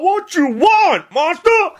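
high, drawn-out country holler; storyteller's high mock yell; retold yell. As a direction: holler it like a bold old-timer. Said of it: "I yelled out".

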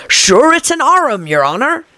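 arum said as two syllables, AIR-um; Pat's arum. A narrator's reading. Pat answering the Rabbit, saying arum for arm. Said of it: "He pronounced it arum".

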